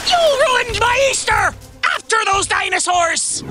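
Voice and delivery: loud, high angry roar; furious pirate shout. Acted. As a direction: bellow at the fleeing crew. Pirate captain shipwrecked, furious.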